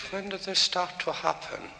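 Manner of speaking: put-on quiet doctor in a Japanese accent; lightly voiced Japanese-accented doctor. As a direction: ask it softly, with a hint of Japanese accent. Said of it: "In a Japanese accent".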